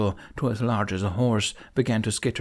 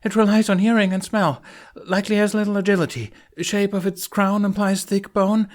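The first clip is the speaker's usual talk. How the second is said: much higher, louder, fast nervous rattle of facts; nervous rattling; 10 semitones higher, 5 dB louder than usual. A narrator's reading. Fishlegs briefing Hiccup under attack, nervous rattle. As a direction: gabble the facts through fear. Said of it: "calmed his nervous stutter".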